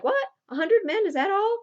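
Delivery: high, loud, mocking disbelief at the odds; scoffing bravado. Cyrano scoffing at Lignière's fear, high and loud. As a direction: laugh off a hundred men, voice jumping high.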